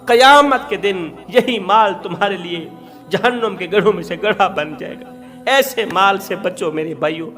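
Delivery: ordinary delivery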